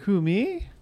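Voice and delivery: high, put-on voice for the pet; pet's innocent question. Co-host voicing the pet caught eating the plant, innocent question. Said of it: "She's like".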